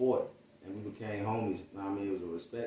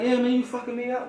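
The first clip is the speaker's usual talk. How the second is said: a high, loud put-on voice for his friend; imitating his friend; 14 semitones higher, 5 dB louder than usual. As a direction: play the hyped friend, pitched up and loud. Friend answers, voice acted.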